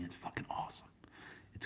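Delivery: excited whisper, very soft; hushed thrilled aside. Real, not acted. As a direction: whisper it like a secret. Whispered excitement about gift bottle.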